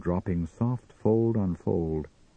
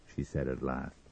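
plain reading voice

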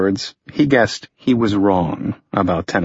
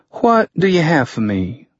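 put-on Southern accent; 7 semitones higher than usual. A narrator's reading. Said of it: "a distinct Southern accent".

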